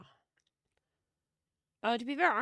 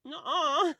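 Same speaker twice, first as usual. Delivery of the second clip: playful refusal; 5 semitones higher, 8 dB louder than usual. Real, not acted. Protesting the French joke.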